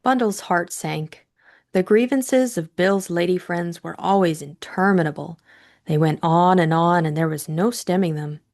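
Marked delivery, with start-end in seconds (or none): none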